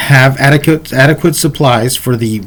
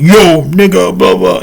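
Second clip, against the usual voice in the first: high, raised mock street call; pitched-up imitation of a swaggering kid; imitated tough kid; 6 semitones higher than usual. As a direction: mimic a tough kid calling out. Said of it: "he would use the N-word".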